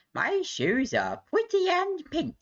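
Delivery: very high, slow sing-song; silly put-on voice. Streamer acting out a runway chat line, silly high voice. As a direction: pipe it up daintily, drawn out.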